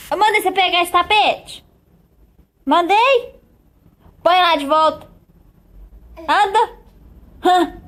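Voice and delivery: loud, firm parental telling-off; scolding. Real, not acted. Scolds child over the rug. (bronca firme em voz alta)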